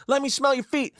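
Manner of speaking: high-pitched mock heckle; imitated creep. Parade creep calling out to contestants, high mock heckle. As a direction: mimic an over-eager creep begging from the crowd. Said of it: "the real fucking creeps".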